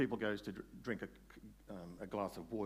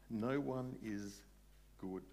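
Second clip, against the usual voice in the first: each word set down slowly; slow, emphatic repetition; slow, weighty; much slower than usual. Real, not acted. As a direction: let it sink in, word by word. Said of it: "worth thinking about".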